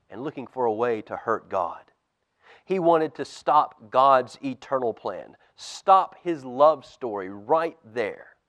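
put-on lively storybook reading for kids; reading to children. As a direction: tell it like a bedtime Bible story. Pastor reads Bible story to kids.

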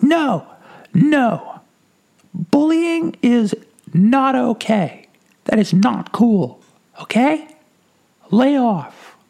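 put-on very high, slow child's scolding voice; a small boy telling bullies off; little boy voice. Little boy scolding the bullying couple, high childlike lecture. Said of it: "this little boy ran up".

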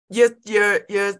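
normal talking voice

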